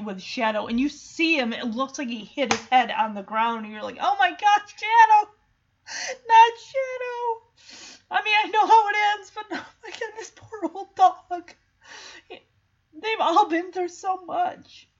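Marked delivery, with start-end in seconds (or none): 4.0-7.4 high, loud wail of dismay; distressed outcry. Retold or imitated. Reacting in distress to Shadow's fall. 8.1-14.9 high, choked-up lament; tearful sympathy. Real, not acted. Upset for the poor old dog.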